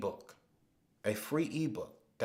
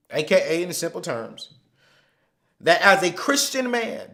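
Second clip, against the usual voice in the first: preaching; 4 semitones higher, 10 dB louder than usual. Acted. Preaching goals with full force.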